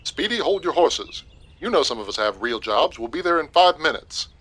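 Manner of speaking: grumbling softly over the phone; complaining. Acted. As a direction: grouse about being rushed.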